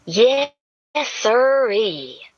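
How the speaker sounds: breathy Southern drawl. A narrator's reading.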